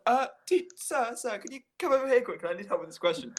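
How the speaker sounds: high, quick mock plea for help, sir sir; squeaky hammed-up kid calling the teacher over; urgent kid calling sir. Kid at the smelly table calls the teacher over, high mock urgency. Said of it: "calls him over and is like".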